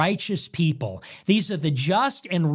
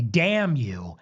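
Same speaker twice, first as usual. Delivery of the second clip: "damn you" said lower and slow, heavy fall; low deliberate curse; 7 semitones lower than usual. Real, not acted. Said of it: "but seriously".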